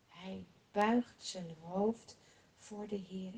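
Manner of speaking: plain reading voice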